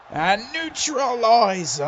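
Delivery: high, slow, loud call; excited move call. Real, not acted. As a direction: announce the finisher big. Calling the finisher.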